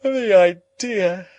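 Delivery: very high, loud, stretched-out scoff; long, high-pitched ladylike exclamation; stifled-yawn exclamation. A narrator's reading. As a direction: draw it out high, as through a checked yawn. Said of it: "checking a yawn".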